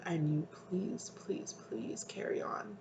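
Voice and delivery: slow, breathless whispered pleading; a spirit's hushed plea, slow and soft. Acted. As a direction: beg them softly, barely voiced. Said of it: "they're like".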